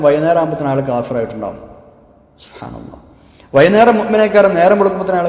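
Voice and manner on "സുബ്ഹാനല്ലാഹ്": a low, soft breath of praise under the voice; murmured subhanallah. Real, not acted. Preacher to himself between points, softly murmured. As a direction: sigh it to yourself.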